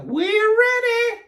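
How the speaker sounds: long-drawn, high, loud call. A narrator's reading. Amelia Bedelia tells the guests, calling out high. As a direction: sing out the announcement, slowly and high. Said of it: "called Amelia Bedelia".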